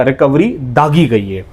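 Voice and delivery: normal talking voice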